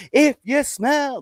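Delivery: high, slow drawn-out catchphrase; high hammy wrestling catchphrase; Rock catchphrase. Retold or imitated. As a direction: stretch it like a wrestling promo. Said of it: "famous catchphrase".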